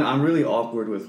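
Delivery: normal talking voice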